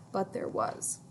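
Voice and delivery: ordinary delivery, nothing marked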